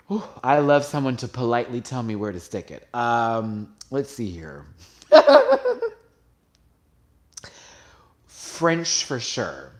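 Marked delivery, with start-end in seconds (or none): 5.1-5.9 loud, very high burst of laughter; squealing laugh in quick pulses; high giggle. Real, not acted. Tickled by own joke.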